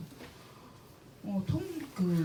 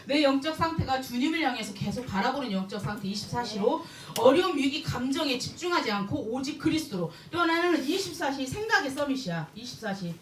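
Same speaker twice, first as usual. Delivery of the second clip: ordinary talk, nothing marked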